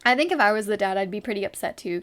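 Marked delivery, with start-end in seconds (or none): none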